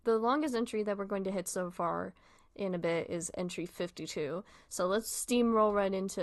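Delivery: usual speaking voice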